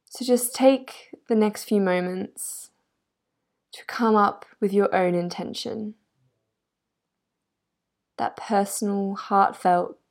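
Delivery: calm, measured yoga nidra voice with long pauses; guided meditation. Real, not acted. Yoga guide helping a listener get comfortable, guided meditation. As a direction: settle the listener gently, leaving space between phrases.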